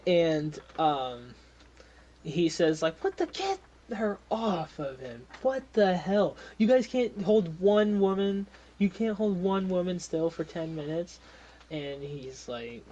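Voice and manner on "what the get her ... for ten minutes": irate boss, voice pushed up; exasperated scolding. Acted. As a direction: berate incompetent guards.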